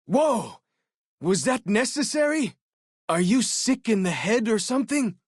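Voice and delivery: high, loud appalled exclamation; shocked outburst. Acted. Companion appalled at the player's violence, shocked. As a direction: recoil at what the player did.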